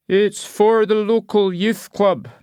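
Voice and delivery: put-on high, slow old raffle seller; creaky old local pitching tickets; old Scottish man. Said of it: "pronouncing it youth".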